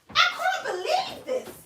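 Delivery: high, laughing disbelief. Real, not acted. Tarot reader laughing at a viewer's confession, laughing disbelief. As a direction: laugh it out, can't believe it. Live reader reacting to shocking question.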